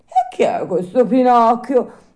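high, loud puppet playing innocent; mock-innocent puppet question; feigning ignorance. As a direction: ask as if you had no idea. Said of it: "domandò il burattino facendolo gnorri".

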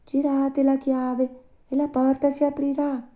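very high little voice; tiny voice. A narrator's reading. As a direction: instruct visitors in a tiny squeak from inside. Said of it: "disse la solita vocina".

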